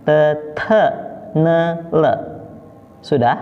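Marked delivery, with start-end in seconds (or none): 0.0-2.1 "Te, te" unpuffed then puffed, then "ne", "le"; separate, even syllables "Te, te, ne, le"; pinyin sound drill. Shown as a demonstration. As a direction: model "Te, te, ne, le" as schwa syllables. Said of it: "kita pelajari dulu sampai tuntas".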